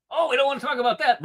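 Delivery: high, fast mock of the other side; mocking the other side's dodge; mocking sneer. Mocking opponents' avoidance.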